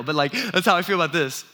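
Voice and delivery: ordinary talk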